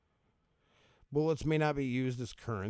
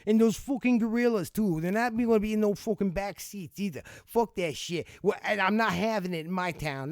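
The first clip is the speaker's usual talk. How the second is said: higher, louder hammed-up outrage; mock furious rant; 6 semitones higher, 6 dB louder than usual. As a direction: rant like an outraged local yelling about gorillas. Ranting at the gorilla law.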